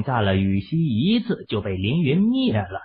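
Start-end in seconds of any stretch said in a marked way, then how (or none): none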